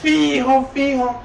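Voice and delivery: high, very slow, stretched vowels; drawn-out mock certainty. Friend mimicking guys hunting a profile online, drawn out and high.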